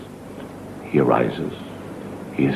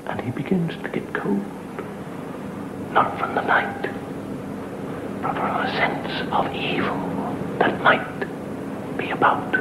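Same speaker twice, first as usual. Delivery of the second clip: eerie whisper; 10 semitones higher, 5 dB quieter than usual. A narrator's reading. Storyteller whispers to the audience, creepy whisper. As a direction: breathe the words to scare the listeners. Spooky whispered horror narration.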